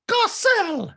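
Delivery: very high, loud name-call; hammed-up outraged squawk; mock scolding shriek. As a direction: screech the name like an offended patron. Doreen scolds Garcelle by name.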